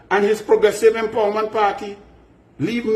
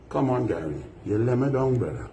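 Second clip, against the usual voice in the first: quiet, much lower let-down sign-off; disappointed; 7 semitones lower, 5 dB quieter than usual. Real, not acted. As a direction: sigh it out like a friend who was let down. Disappointed farewell to Gary.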